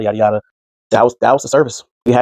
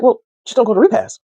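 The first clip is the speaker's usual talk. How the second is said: higher, breezy brush-off; mock offhand advice; 10 semitones higher than usual. [name] brushing off the problem, high and breezy. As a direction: toss it off lightly.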